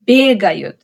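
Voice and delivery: "бегают" with stress on the opening бе-; first-syllable stress. Shown as a demonstration. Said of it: "The stress falls differently in each one".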